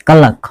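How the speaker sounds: क्लॉक as a short "ka-lak"; correct pronunciation. Shown as a demonstration. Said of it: "क्लॉक पढ़ेंगे". (क्लॉक में छोटा "अ" बोलें)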